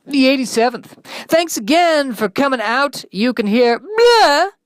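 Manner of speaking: high, loud, bright-voiced radio host. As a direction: pump up the show like a cheesy DJ.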